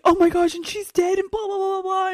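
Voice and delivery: hammed-up grief, trailing into blah blah; lightly voiced frantic boyfriend; mock panicked hero. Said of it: "And he's like".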